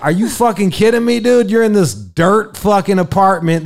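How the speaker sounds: high, loud disbelieving outburst; outraged. Retold or imitated. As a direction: blow up in disbelief. Outraged at girl's condom demand.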